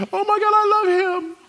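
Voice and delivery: very high, loud squeal of a crush; gushing teenage girl. Acted. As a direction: squeal it like a swooning teenage fan. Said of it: "teenage girl love".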